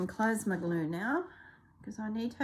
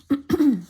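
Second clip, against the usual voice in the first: throat clearing; 7 semitones higher, 11 dB louder than usual. Real, not acted. Throat clearing mid-talk.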